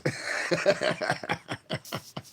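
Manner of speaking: long, soft, voiceless laugh; breathy chuckle. Real, not acted. After a shout-out to Queens, long breathy laugh. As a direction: wheeze out a drawn-out chuckle.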